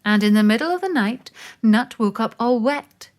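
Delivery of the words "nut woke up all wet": ordinary delivery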